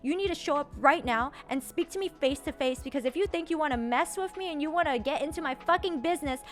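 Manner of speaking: loud, high, confrontational rant; furious tirade, acted a notch below screaming; retold angry screaming. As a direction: imitate a man yelling out a challenge. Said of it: "he's screaming so loudly".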